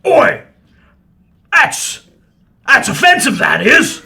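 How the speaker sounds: loud, high indignant troll; offended troll shout. Acted. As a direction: bellow it like an insulted troll.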